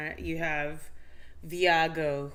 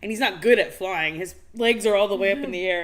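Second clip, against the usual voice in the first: normal talking voice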